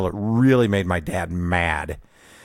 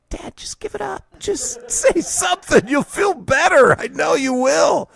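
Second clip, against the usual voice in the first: put-on kid pleading.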